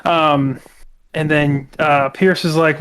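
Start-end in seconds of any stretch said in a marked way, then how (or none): none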